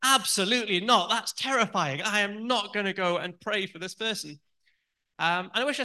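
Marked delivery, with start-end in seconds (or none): 0.0-4.4 high, loud panicked refusal; fearful inner voice. Retold or imitated. Her fear refusing to pray for the registrar, in a high, loud panicked no. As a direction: shout it down like fear taking over. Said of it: "which was like".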